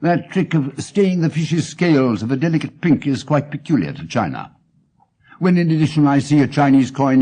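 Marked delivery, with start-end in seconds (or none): none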